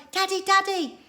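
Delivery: high, loud joyful call; crying out. Acted. The children calling to their dad. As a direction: shout for daddy across the plain. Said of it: "they cried".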